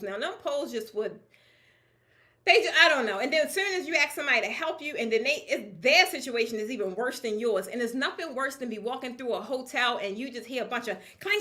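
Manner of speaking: ordinary talk, nothing marked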